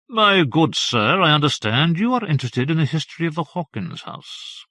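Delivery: an eager local scholar, lightly played; lightly voiced old scholar. A scholar greets his visitor over Madeira, lightly voiced and eager. Scholar Farberdy addresses the narrator.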